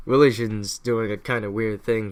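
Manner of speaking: ordinary talk, nothing marked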